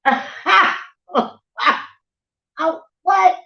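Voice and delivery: high loud what; shocked outrage; incredulous. Retold or imitated. Reacting to the disrespectful man, loud high incredulous what.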